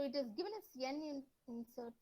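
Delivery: trailing off almost inaudibly; barely audible. Real, not acted. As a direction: voice fading too low to hear. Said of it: "Voice is low".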